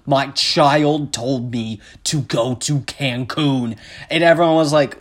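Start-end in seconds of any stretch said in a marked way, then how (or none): 0.1-3.8 slow, plodding dopey impersonation; halting, put-on dim politician voice; mock politician. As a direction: drag out each word stiffly. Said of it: "if he was like".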